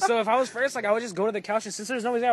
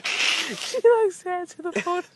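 mocking repeat; 10 semitones higher, much slower than usual. Mocking repeat of Paul's line.

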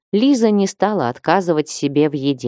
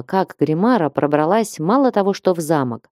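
ordinary delivery

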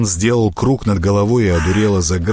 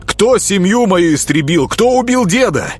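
furious accusation; 10 semitones higher than usual. A narrator's reading. Grekhvost accusing the prince of killing his family, furious. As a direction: fling the questions at him.

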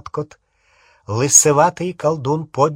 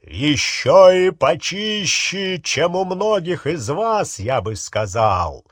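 drawn-out, emphatic pronouncement; loud declaration; 4 semitones higher, slower than usual. A narrator's reading. Harry challenges the watching wizards, loud declaration. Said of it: "голос его громким эхом прокатился".

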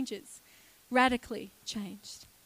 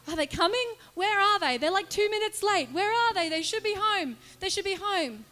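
higher, worried pacing voice, fretting at the street; anxious waiting grandfather; 6 semitones higher, 3 dB louder than usual. Retold or imitated. Granddad pacing the street for his late family, higher and worried. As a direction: fret like a granddad who keeps checking the road.

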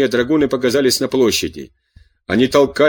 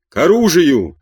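raised, higher-pitched call to arms; shouted call; 4 semitones higher, 3 dB louder than usual. A narrator's reading. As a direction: cry it out higher, as people running would. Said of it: "кричали бежавшие". (приподнятый призывный крик)

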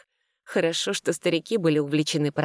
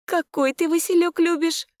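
put-on high girlish question; 13 semitones higher, 4 dB louder than usual. A narrator's reading. High far heroine voice.

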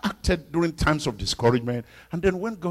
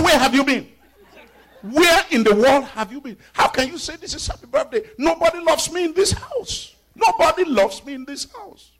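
high, loud, hammed-up rant at the family; mock angry outburst; 9 dB louder than usual. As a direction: play the sulking birthday husband yelling. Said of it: "I said".

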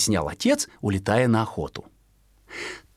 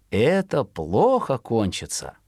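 plain reading voice

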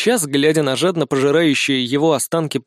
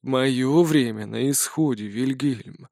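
put-on slow, quiet calm old man; 3 semitones lower, 5 dB quieter, slower than usual. A narrator's reading. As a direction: accept death calmly. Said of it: "спокойно сообщил он".

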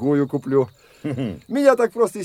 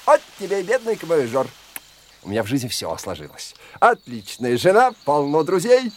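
ordinary talk

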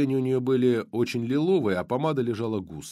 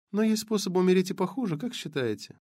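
lightly voiced blonde; 9 semitones higher than usual.

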